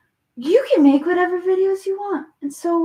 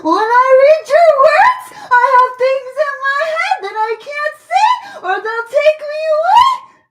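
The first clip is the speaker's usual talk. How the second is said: much higher, much louder fake crying shout, laughing through it; mock wailing; 9 semitones higher, 10 dB louder than usual. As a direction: ham up a hysterical sob. Mocking reading of comment.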